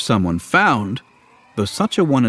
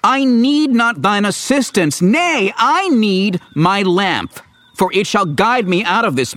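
exasperated; 8 semitones higher than usual. Acted. Luke argues over lamp.

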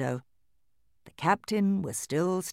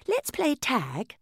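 put-on doll girl voice; 10 semitones higher, slower than usual. A narrator's reading. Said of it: "she said to her brother".

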